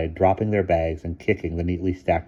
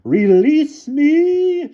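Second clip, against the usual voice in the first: very slow, high, loud shrill; 10 dB louder, much slower than usual. A narrator's reading. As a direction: drag it out shrilly. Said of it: "shrilled Miss Scrimmage".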